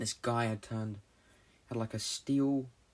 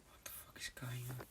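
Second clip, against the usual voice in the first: whisper; 3 semitones higher, 12 dB quieter than usual. Retold or imitated. Confused dream quote, whispered.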